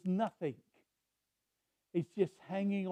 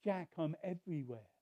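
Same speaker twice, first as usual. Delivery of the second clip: calm Lord voice; 6 dB quieter than usual. Retold or imitated. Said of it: "He said".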